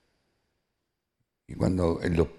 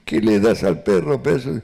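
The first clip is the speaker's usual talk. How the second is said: father scolding; 4 semitones higher, 6 dB louder than usual. Retold or imitated. Father scolding about the dog.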